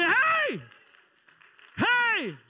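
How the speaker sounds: high, loud whoop; shouted high hey; cheering. Pretend. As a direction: cheer like waving pom-poms. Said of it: "I'm gonna say".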